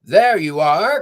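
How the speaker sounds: loud, slow call; cheerful cry. A narrator's reading. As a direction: call out proudly. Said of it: "cried the pickup truck".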